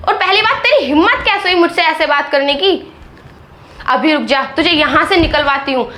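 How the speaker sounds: usual speaking voice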